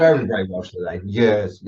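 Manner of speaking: imitating a Welsh accent on years. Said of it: "how you say years is hilarious".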